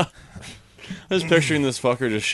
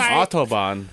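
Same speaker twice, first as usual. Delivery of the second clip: mock German accent; slower than usual. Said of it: "German accent".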